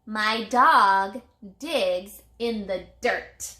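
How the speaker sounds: slow, careful, every word clear; slow model sentence. Shown as a demonstration. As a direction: read it slowly, word by word, for children. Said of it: "model sentence read slowly".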